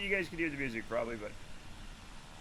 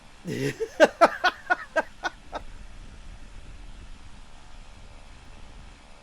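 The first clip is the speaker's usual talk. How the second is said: long laughing fit. Real, not acted. Streamer cracks up over wild beast, laughing. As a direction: burst out laughing, then trail away.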